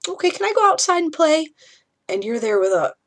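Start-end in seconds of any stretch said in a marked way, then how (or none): 0.1-1.5 put-on high, quick and bright like a small kid, louder; a little kid who doesn't get it yet; carefree child voice. Parent mimics the child asking to go out and play, chirpy high.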